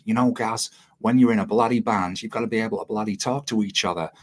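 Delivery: put-on poor Australian accent. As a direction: imitate an Aussie bandmate, badly. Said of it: "poor Australian accent time".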